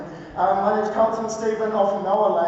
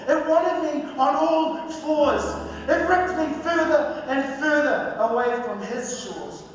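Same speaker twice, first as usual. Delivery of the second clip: higher-pitched, measured poetry recital with weighted pauses; spoken word poetry; 6 semitones higher than usual. Acted. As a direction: deliver it as a rhythmic spoken word testimony. Performs spoken word poem.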